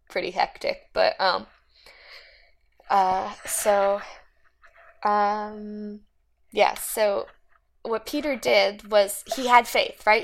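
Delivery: normal talking voice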